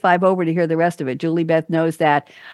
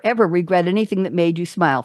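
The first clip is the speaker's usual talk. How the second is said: ordinary talk, nothing marked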